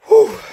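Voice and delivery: loud gasping exhale after lifting; breathless whew. Real, not acted. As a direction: blow out a winded whew. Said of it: "just a little out of breath".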